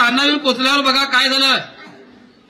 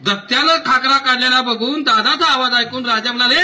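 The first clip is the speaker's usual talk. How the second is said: ordinary delivery, nothing marked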